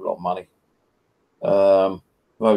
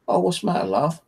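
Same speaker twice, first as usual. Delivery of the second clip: a light, higher-pitched imitation of a helpful cashier; cashier's voice; 8 semitones higher than usual. Cashier asking a customer about a problem, lightly imitated. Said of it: "She goes".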